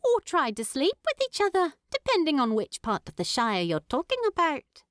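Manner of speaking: quick, amused hobbit lady teasing; lightly voiced Primula, fast and wry.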